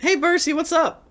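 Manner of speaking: bright high-pitched hello; cheery high greeting. Acted. Character greets over sending stone.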